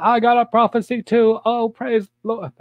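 high mock church lady; mock prophetess. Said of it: "Another fat lady gets up".

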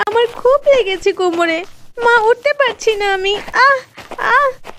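groaning with a hurt back; crying out in pain. Acted. Girl hurt, cannot get up.

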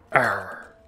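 low growled arr; pirate arr. Acted. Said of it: "how much you hated my pirate voice".

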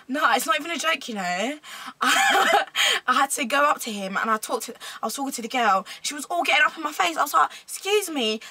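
affronted, put-on Essex voice; Essex accent. Shown as a demonstration. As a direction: dish the drama in an Essex accent. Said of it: "it's six, it's six".

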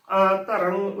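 ਅਧਰੰਗ as a-tarang, opening a on its own; correct separated pronunciation. Shown as a demonstration. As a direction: split ਅਧਰੰਗ into a, ta, rang. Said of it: "ਉਚਾਰਨ ਕਰਨਾ ਹੈ".

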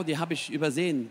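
normal talking voice

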